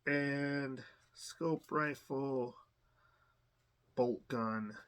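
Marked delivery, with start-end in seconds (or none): none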